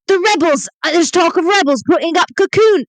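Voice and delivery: high, breathless alarm about rebels; frantic messenger. Pretend. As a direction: sound the alarm in a put-on voice. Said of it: "Do a good voice".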